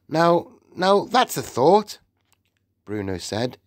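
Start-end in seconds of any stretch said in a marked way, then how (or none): 0.1-2.0 high, newly interested voice; perking up. A narrator's reading. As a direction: brighten up at a tempting idea. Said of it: "perking up a bit".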